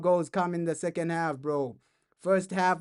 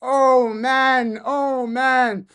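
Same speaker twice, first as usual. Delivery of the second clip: excited sigh; 6 semitones higher, 7 dB louder, much slower than usual. Real, not acted. Still celebrating.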